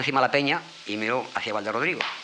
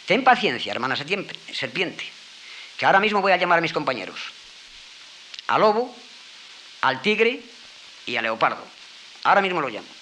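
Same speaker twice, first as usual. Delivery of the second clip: higher, louder, hurried promise; eager fox; 6 semitones higher, 4 dB louder than usual. Acted. Fox promising the trapped serpent help, eager and hurried. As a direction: rush to reassure, voice up and bright with urgency.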